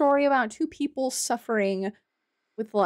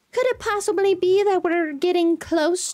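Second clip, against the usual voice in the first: mock suspense; 6 semitones higher, 4 dB louder than usual. Teasing about the final boss.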